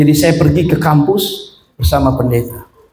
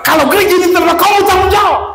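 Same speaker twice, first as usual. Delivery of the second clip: furious shout; faster than usual. Retold or imitated. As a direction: scream the blame at him. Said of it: "dia bilang".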